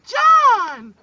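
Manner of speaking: high, loud shout of his name; mom shouting. Acted. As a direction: yell his name across the house. Mom calls out at the door.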